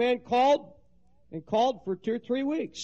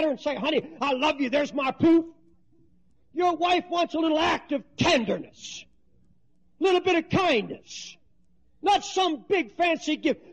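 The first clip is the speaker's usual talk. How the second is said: preaching shout; 5 semitones higher, 3 dB louder than usual. Acted. Preacher scolding the crying pastor, loud and high. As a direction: shout him down from the pulpit.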